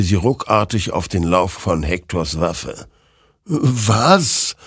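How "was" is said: high-pitched startled question; puzzled surprise. Acted. Hector wonders at the creature's gesture, startled. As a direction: let the word jump up in wonder. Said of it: "wunderte sich dieser".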